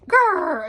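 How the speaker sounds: loud, high imitated tummy rumble; stomach growl. A narrator's reading. Pooh's empty tummy startles Piglet, loud growl. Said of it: "A strange noise startled Piglet".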